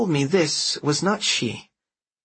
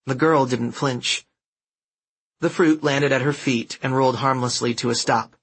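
ordinary delivery, nothing marked

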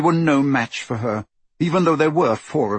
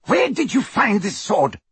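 high-pitched insistent questioning; insistent demand; 9 semitones higher than usual. A narrator's reading. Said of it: "she repeated".

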